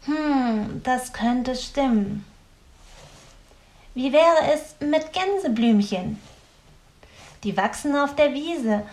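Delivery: leicht höhere, nachdenkliche Stimme; Grünäuglein überlegt. A narrator's reading. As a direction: eine Spur Grünäuglein, grübelnd. Grünäuglein voiced higher. (Grünäuglein, leicht erhöht und nachdenklich)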